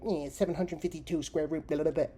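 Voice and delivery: loud, fast math-whiz voice; rattling off numbers. Retold or imitated. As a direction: spout numbers like a genius kid. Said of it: "these fucking kids are like".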